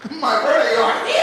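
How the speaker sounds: loud, high Nigerian exclamation; booming mock Nigerian voice; mimicked Nigerian accent. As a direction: announce it in hammed-up Nigerian English. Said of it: "I did that with a".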